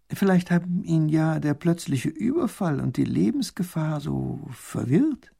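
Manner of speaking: ordinary talk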